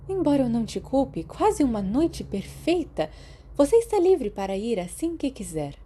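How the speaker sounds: a gentle nurse reassuring her patient; hint of a motherly healer; kindly school nurse. Narrator voices Madame Pomfrey.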